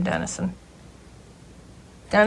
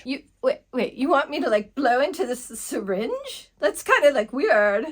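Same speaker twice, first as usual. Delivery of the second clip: put-on higher, grossed-out valley girl; valley girl teen squeamish about the syringe; ditzy teen girl; 4 semitones higher than usual. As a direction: balk at the weird request.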